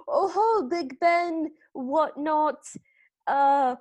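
loud, hammed-up British accent; mock-British bluster, groping for words; terrible British accent. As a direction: fumble through a bad, plummy British impression. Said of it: "in a terrible British accent".

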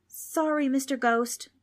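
put-on high, meek boy's voice; apologetic boy. A narrator's reading. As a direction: apologize politely to a ghost. Polite boy apologizes to ghost.

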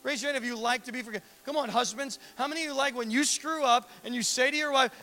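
ordinary talk, nothing marked

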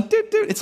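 high squeaky doot doot beeps; two high electronic beeps; imitated phone beep. Imitates phone battery drop.

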